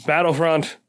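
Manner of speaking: ordinary delivery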